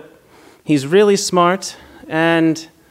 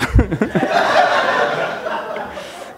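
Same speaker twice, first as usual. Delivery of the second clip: breathy laugh. Real, not acted. Laughs after girlfriend's line.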